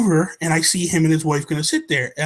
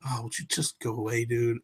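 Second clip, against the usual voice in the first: soft, dismissive go away, much quieter; muttered brush-off; 5 semitones lower, 11 dB quieter than usual. Retold or imitated. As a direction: wave him off under your breath. Brushing off obnoxious guy.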